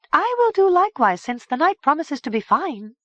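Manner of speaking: put-on high, light young woman voice. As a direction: voice a calm young heroine. Madook speaks.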